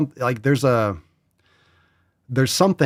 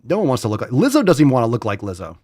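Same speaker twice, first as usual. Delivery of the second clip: ordinary delivery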